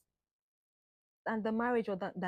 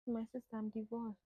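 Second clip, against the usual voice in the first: quiet confession; 8 dB quieter than usual. Retold or imitated. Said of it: "Me who ye o".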